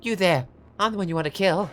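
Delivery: high, loud call to an attacker; defiant challenge. Acted. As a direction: call out boldly to draw attention. Lily calls out challenge to attacker.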